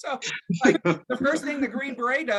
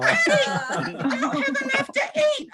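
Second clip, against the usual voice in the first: put-on much higher kid's whine; whiny child voice. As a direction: whine like a little boy begging his dad. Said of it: "youngest son complains again".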